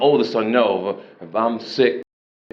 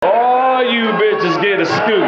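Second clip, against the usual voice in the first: slow loud shouted giveaway; mock shout; 7 semitones higher, 3 dB louder, slower than usual. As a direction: holler it across the floor.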